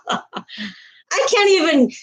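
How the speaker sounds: laughing. Real, not acted. Laughing at viewer's Thailand comment.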